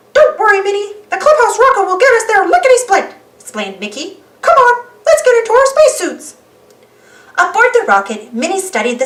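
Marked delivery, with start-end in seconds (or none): 0.1-6.3 high, loud cartoon mouse; upbeat mouse rallying friends; Mickey voice. Mickey's voice held.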